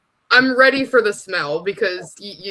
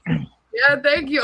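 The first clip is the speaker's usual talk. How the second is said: ordinary talk, nothing marked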